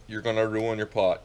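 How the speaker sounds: ordinary talk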